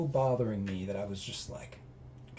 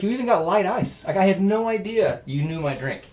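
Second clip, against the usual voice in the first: high, loud, amazed listing of the drink; wife stunned and thrilled; wife amazed he knew her exact order; delighted surprise; 8 semitones higher, 6 dB louder than usual. Retold or imitated. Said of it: "she's like".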